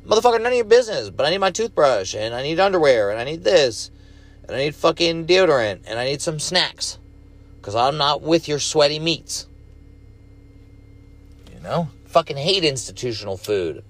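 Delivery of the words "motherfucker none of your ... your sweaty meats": much higher, fast exasperated rant at the nurse; irritated outburst. Retold or imitated. To the nurse asking what he needs, a high fast irritated rant. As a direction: snap back at her like she's being nosy. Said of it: "I'm like".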